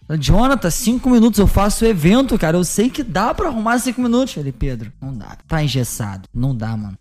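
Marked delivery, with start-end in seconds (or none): 0.2-4.3 high, louder begging voice; retold begging, pitched up and pushed; pleading. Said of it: "comecei a me humilhar". 4.5-7.0 lower, softer curt refusal; lightly voiced organizer saying no; Jonathan refusing.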